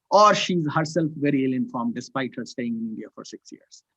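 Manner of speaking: normal talking voice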